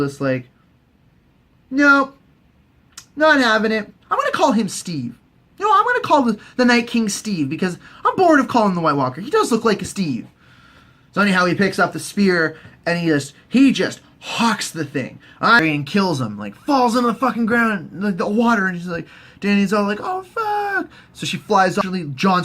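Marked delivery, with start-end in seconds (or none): 1.7-10.3 high-pitched, emphatic comic refusal; mock refusal. Voicing the unimpressed Night King. 20.0-20.9 high, drawn-out comic dismay; mock dismay. Dany sees her dragon fall.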